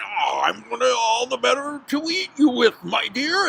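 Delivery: loud, rising wolf roar; chasing big bad wolf. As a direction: call it out high and loud, giving chase. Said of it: "the wolf was going".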